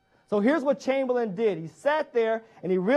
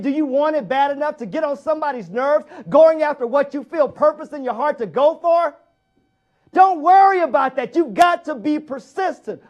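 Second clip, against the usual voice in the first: fired-up exhortation; 6 semitones higher, 8 dB louder than usual. Real, not acted. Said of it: "preacher pushing the audience".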